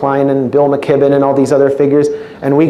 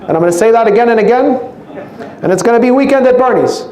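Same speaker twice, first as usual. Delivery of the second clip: much higher, fast ranting Bernie voice; hammed-up Bernie stump speech; Bernie Sanders impression; 8 semitones higher, faster than usual. Comedian doing a promised Bernie impression for the crowd, high and fast. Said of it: "I would do a Bernie Sanders impersonation".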